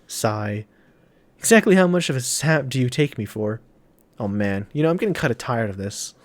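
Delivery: ordinary delivery, nothing marked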